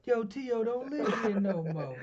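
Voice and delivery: high, loud, slow mock voice; put-on door answer. Fending off whoever is at T O's door, mock hollering. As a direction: holler it slowly, covering for someone.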